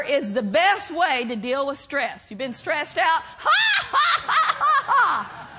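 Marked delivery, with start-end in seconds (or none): 3.4-5.5 high-pitched forced "ha-ha" laugh; demonstrated belly laugh. Shown as a demonstration. Preacher shows congregation the cure for stress, demonstrated laugh. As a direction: burst into a deliberate laugh. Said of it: "Faking a laugh like I just did".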